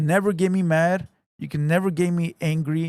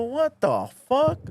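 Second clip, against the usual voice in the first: high, slow disbelieving what; mock-shocked uncle voice; incredulous; 8 semitones higher, much slower than usual. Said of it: "I go".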